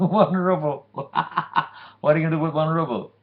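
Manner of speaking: high, loud words through laughter; mocking laugh at a poor man's single coin; laughing mockery. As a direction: scoff at him while laughing. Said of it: "They started laughing".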